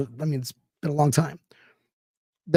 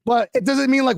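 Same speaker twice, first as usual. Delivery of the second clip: ordinary talk, nothing marked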